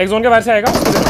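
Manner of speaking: loud, urgent shout to teammates; shouted game callout. Real, not acted. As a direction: yell the order over the fight. Urgent callout during a fight.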